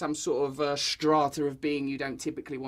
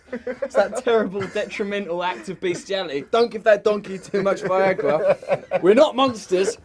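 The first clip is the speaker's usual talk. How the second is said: mock stern order; 3 semitones higher, 7 dB louder than usual. Mock doctor laying down rules.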